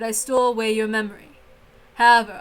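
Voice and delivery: ordinary delivery, nothing marked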